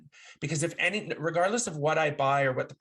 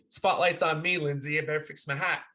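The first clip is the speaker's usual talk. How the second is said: ordinary talk, nothing marked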